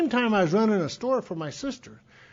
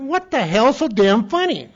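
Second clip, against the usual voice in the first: slow, louder mock-annoyed question; irritated demand; 7 semitones higher, 11 dB louder than usual. Speaker retells demanding why his sister is laughing, slow loud annoyance. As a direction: snap it out, exasperated and higher.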